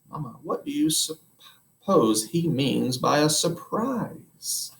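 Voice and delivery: dreamy husband musing; wondering. Acted.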